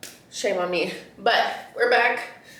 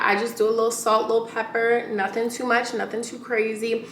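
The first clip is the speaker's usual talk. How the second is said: ordinary delivery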